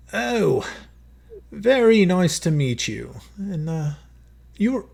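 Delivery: a jovial monarch sizing up a guest; king's voice. Acted. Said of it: "he just looks you over and he says".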